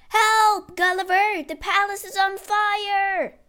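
loud, high alarm call; shouting. A narrator's reading. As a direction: shout for help as the fire spreads. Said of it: "he was woken by shouting".

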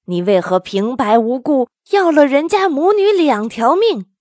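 storybook master shouting down his disciple; stern rebuke. A narrator's reading. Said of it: "被唐僧喝住". (严厉喝止，越说越高)

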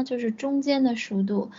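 usual speaking voice

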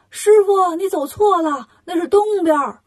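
loud high call across a distance; shouting after him. Acted. Wukong shouting after the fleeing master, loud call. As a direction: yell to stop him running the wrong way. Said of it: "忙喊".

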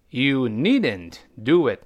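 YOU NEEDN'T DO IT drawn out word by word; unhurried, spaced-out teaching repeat; slow model reading. Shown as a demonstration. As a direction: read the example slowly for learners. Teacher repeats example slowly.

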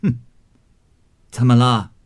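put-on old matriarch demanding sternly; stern question. A narrator's reading. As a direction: grandmother confronting her kneeling grandson. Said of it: "贾母问他".

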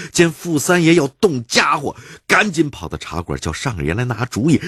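ordinary delivery

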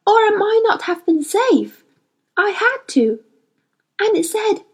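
put-on high, earnest girl voice; a girl confiding eagerly; Lyra, earnest. A narrator's reading. Girl explaining the instrument to the boy, earnest.

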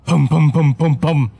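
rapid repeated pounding sounds made by voice; imitated door banging. A narrator's reading. Ghost woman ramming the toilet door, imitated banging. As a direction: voice the thuds on a heavy door. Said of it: "发出震耳欲聋的巨响".

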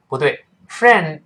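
ordinary talk, nothing marked

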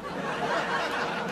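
quiet laugh. Real, not acted. Host laughs at joke.

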